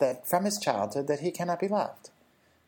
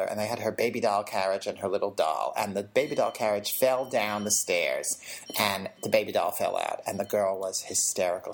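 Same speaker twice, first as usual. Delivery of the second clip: ordinary delivery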